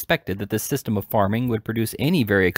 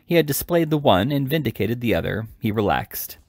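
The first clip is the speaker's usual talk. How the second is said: ordinary delivery, nothing marked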